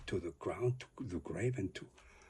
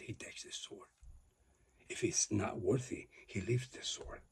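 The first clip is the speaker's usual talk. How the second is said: normal talking voice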